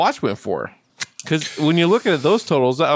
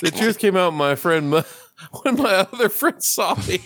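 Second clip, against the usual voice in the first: normal talking voice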